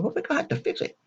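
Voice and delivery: high, strained put-on voice of the doctor; mock doctor voice. The doctor greeting the patient in a high mock voice. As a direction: squeeze the voice up high to play him. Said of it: "He said".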